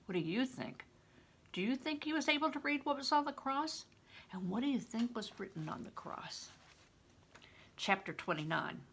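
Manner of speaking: ordinary delivery, nothing marked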